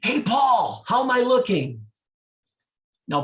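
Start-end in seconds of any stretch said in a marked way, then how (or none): 0.0-1.9 high, loud call from the back door; calling out loudly to the pool boy across the yard; yelling across the yard. Retold or imitated. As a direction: shout it across the whole yard. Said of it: "she yells across the yard".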